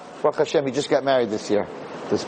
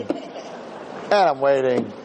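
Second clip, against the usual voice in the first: slow, stretched-out waiting; drawn-out waiting; 2 semitones lower, much slower than usual. Retold or imitated. Rabbi retelling his wait on the mountain, slow and stretched. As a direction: drag it out to show the long wait.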